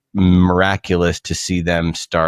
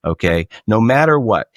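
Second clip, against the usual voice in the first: usual speaking voice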